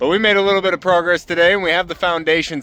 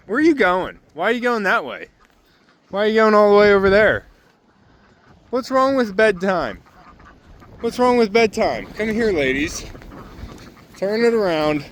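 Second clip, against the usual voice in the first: higher, sing-song coaxing to the birds; coaxing pet talk; 5 semitones higher than usual. Real, not acted. Farmer pleading with wandering ducks to come to bed, higher coaxing pet voice.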